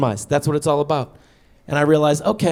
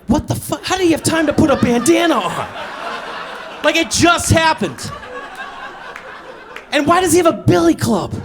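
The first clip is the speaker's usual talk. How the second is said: laughing mock outrage, raised high; incredulous yell; 10 semitones higher than usual. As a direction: yell in amused disbelief. Retold incredulous yelling.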